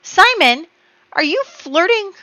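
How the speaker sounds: high, loud disbelief at the flirting; mock outrage. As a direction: scold them in a high, indignant voice. Scolding game characters for flirting.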